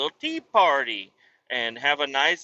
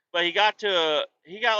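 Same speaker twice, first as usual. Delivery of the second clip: ordinary talk, nothing marked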